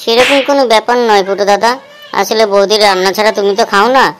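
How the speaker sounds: a follower tiptoeing around the boss's mood; timid follower. Acted. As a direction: answer the boss carefully and quietly. Other character voiced in the skit.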